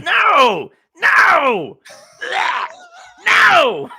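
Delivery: loud, high outraged no; appalled yelling refusal; shouting no. Real, not acted. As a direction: shout it down in disgust. Rejecting sweet potato idea.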